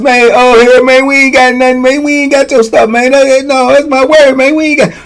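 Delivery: high, hurried mock denial; protesting innocence. Youngins denying they took his stuff, protesting. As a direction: imitate accused youngsters pleading they have nothing. Youngins deny taking the teeth.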